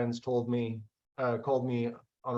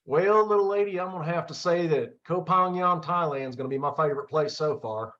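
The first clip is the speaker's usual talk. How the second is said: put-on Southern accent; 4 semitones higher than usual. Answering host about favorite place, Southern accent. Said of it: "In your best Southern accent".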